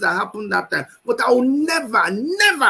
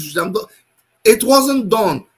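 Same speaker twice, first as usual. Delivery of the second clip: ordinary talk, nothing marked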